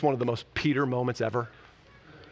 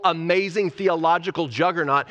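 ordinary delivery

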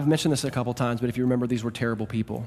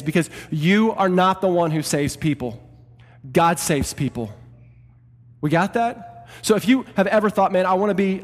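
raised, loud impassioned preaching voice; building sermon delivery; full preaching; 5 semitones higher, 4 dB louder than usual. Acted. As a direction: preach it to the whole church with fire. Pastor preaching to church.

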